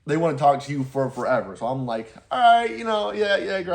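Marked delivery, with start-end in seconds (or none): 2.3-3.8 higher, louder mock Midwest accent. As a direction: play up a folksy Midwest accent. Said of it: "I'm doing the Midwest accent".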